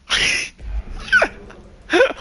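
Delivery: high burst of laughter; sudden high cackle of amusement; laughing. Real, not acted. Cracks up after accent reading.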